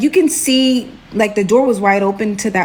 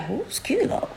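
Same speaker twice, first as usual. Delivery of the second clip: much softer, higher, baffled murmur; hushed puzzlement; 6 semitones higher, 11 dB quieter than usual. Retold or imitated. Listener wondering to herself in next room, hushed bafflement. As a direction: ask it under your breath, confused.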